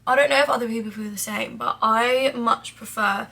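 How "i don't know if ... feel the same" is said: normal talking voice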